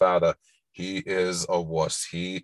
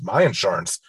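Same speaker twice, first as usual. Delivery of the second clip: louder, higher, fast angry ranting; bully him down, indignant; bullying yell; 4 semitones higher, 3 dB louder, faster than usual. Retold or imitated. Bully Biff blames George.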